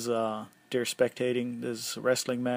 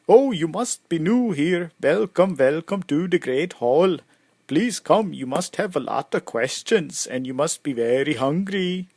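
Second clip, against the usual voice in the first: put-on loud, higher Swedish-accented welcome; 4 semitones higher, 7 dB louder than usual. Hall host greeting newcomers at the door, Swedish accent. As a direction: play a hearty host with a Swedish lilt. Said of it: "sorry, that was my Swedish, uh, accent".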